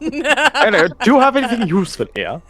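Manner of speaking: put-on child voice breaking into laughter; giggling through the words; laughing. DM laughing in character.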